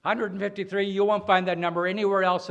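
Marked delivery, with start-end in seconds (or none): 0.0-0.8 Hundred punched higher and louder; stress on hundred. Shown as a demonstration. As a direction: hit Hundred hard, then the rest. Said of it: "I don't".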